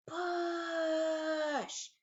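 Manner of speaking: strained grunt of effort, pushing hard; acted straining push. Shown as a demonstration. Teacher acting out pushing with a strained shout. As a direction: grunt it out like shoving a heavy jeep. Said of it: "they may be pushing like this".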